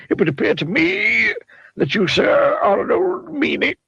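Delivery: high, rambling wizard voice; wordy wizard. A narrator's reading. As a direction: dither like a muddled old wizard. Wizard scolding Mister Mean.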